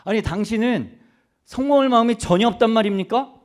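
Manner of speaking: pressing demand. Retold or imitated. Reporters pressing Moody about his ambition, loud incredulous demand. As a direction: push him hard, like you can't believe it. Preacher repeats reporters' question.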